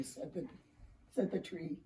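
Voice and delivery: ordinary delivery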